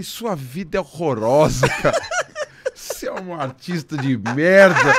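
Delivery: laughing through a mock insult to the drummer; laughing retort. To his drummer, retelling the jab: laughing mock scold. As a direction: tease him while cracking up. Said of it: "Eu falei".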